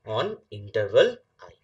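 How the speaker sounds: reads the definition slowly, word by word, for note-taking; slow dictation. Shown as a demonstration. Teacher slowly dictating a definition to the class. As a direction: spell it out slowly so students can write.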